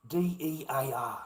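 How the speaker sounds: D E A R spelled letter by letter, slowly; spelling it out. Shown as a demonstration. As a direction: spell out D E A R one letter at a time.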